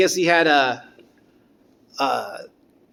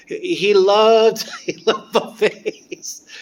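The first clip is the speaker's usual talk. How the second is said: laughing; 5 semitones higher, 6 dB louder, much slower than usual. Real, not acted. Laughing fondly about Pepper.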